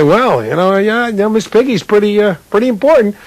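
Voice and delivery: stall, then commit with a straight face; hesitant mock-serious. Rookie analyst weighing in at his first meeting, hesitant.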